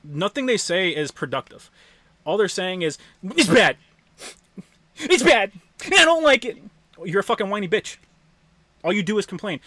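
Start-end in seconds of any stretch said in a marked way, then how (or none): 3.2-6.6 high, loud mocking whine; nasal-free bratty whine, hammed up; mock whining. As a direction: parody a whiny complainer. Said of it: "constantly whining and whinging".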